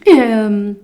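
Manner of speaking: usual speaking voice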